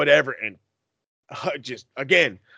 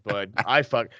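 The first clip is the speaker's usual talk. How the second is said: usual speaking voice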